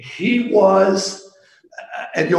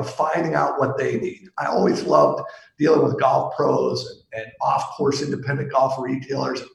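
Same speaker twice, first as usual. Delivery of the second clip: normal talking voice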